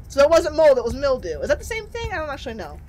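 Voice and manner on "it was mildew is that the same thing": ordinary talk, nothing marked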